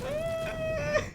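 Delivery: very high drawn-out scream; real high-pitched shriek of fright; startled squeal. Real, not acted. Player caught off guard, startled squeal.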